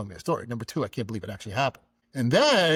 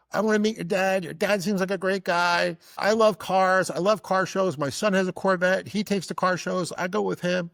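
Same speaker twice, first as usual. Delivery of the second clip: higher, keen woman's voice; eager woman; 6 semitones higher than usual. Retold or imitated. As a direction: lightly voice an eager admirer. Woman's eager message, acted out.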